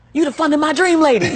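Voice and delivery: teller's gasping delighted recognition, laughing; bright laughing outburst of recognition; excited teller. Retold or imitated. Bank teller recognizing her name, laughing excitedly.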